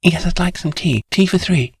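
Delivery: lightly voiced clipped English accent; quaint clipped English accent. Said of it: "with her quaint clipped English accent".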